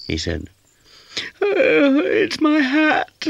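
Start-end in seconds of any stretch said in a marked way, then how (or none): 1.4-3.3 very high, slow crying wail; howling. A narrator's reading. Kitten howling to Towser about her lost hat. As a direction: wail it out through tears. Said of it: "howled Sadie".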